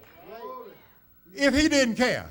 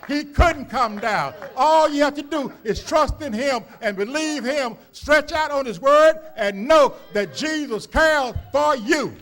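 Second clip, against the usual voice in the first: loud rising preacher's climax, driving each line; preaching at full force; 2 semitones higher, 6 dB louder than usual. Real, not acted. Preacher to the congregation, loud rising climax. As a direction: build the sermon to its peak.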